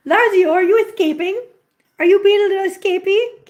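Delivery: high, loud sing-song to a kitten; playful pet-scolding. Real, not acted. Teasing a kitten trying to escape.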